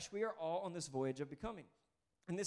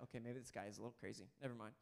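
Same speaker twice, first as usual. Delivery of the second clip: under-breath aside; 7 semitones lower, 11 dB quieter, faster than usual. Retold or imitated. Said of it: "Nicodemus is like".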